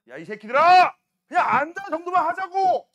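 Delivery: teacher jokingly shouting at nosy students; mock yell. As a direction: bark at the class in jest.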